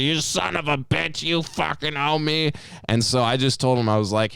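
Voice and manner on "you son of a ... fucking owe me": angry. Retold or imitated. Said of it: "He's like".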